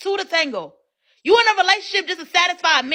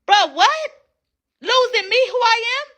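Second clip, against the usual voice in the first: usual speaking voice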